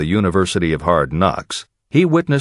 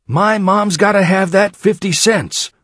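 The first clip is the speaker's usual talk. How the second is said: put-on high-pitched, insistent child's yell; screaming child; 11 semitones higher, 3 dB louder than usual. A narrator's reading. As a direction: cry it out high, demanding as a desperate child. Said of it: "screamed at the top of her voice".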